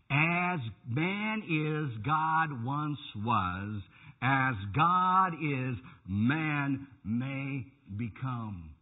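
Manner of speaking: drawn-out, heavily spaced repeat; pointed repetition. Retold or imitated. Preacher repeating a doctrine he finds unbelievable, slow and pointed. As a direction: let each slow phrase hang for scrutiny. Repeats quote after incredulous What.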